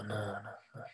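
breathless, scared whisper of no; frightened whisper. Retold or imitated. Waking after the trance, whispering in fear. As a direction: whisper it in terror.

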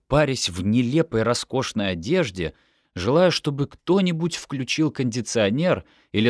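ordinary delivery, nothing marked

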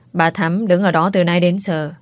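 plain reading voice

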